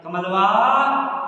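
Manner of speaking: put-on an irritated parent calling a child for the third time; annoyed guardian's call. Shown as a demonstration. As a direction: holler कमलवा across the house like a cross guardian. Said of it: "आपके guardian थोड़ा गुस्से में".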